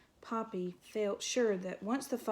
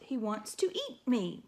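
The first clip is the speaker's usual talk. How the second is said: usual speaking voice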